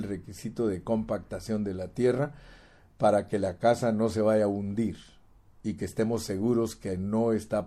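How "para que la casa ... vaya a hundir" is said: ordinary talk, nothing marked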